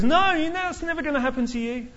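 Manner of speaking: high, loud mock protest; protesting Peter. Peter protesting to Jesus, high and insistent. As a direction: ham up Peter's denial.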